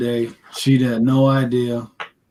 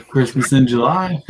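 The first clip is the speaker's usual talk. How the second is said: ordinary talk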